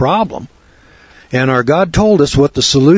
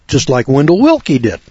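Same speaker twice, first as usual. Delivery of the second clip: normal talking voice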